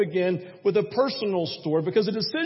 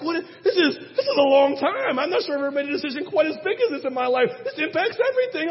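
high, nervous laughing rush; anxious groom laughing through his doubts, high and fast; anxious laughing panic; 8 semitones higher, 3 dB louder, faster than usual. Retold or imitated. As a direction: laugh and panic at once, much higher. Retold panic before proposing.

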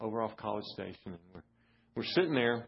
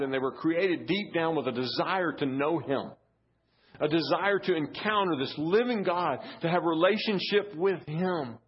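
raised, higher voice building to full force; impassioned preaching; 7 semitones higher, 6 dB louder than usual. Real, not acted. Preacher urging churches to be places of encounter, impassioned. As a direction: drive each phrase home to the congregation.

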